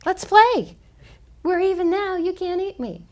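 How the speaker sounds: high, loud, squeaky little animal taunting; little creature voice. Acted. The mouse turned cat teasing the cat, squeaky and loud. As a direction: play a tiny mouse suddenly brave and cheeky.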